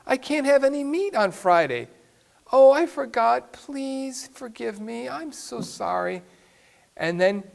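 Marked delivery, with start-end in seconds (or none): 0.1-1.9 higher, insistent protest as the husband; more upset husband. Retold or imitated. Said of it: "he's more upset this time". 2.5-6.2 mock sorry wife. Wife's mock apology voiced.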